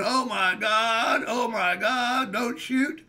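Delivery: slow, stretched frightened plea; scared teen begging the gunman; terrified pleading. Retold or imitated. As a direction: drag out the words in fear. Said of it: "I'm going".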